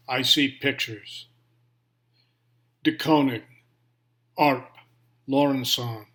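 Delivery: measured recital, phrase by phrase with pauses; poem reading. Acted. Reader shares a late poet's poem, measured poetry recital. Reads Donald Hall's poem aloud.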